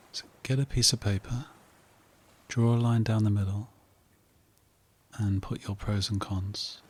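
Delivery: soft, unhurried meditation voice with long pauses; guided meditation. Real, not acted. Host guiding listeners to relax, calm meditation voice. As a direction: settle the listener into calm, phrase by phrase.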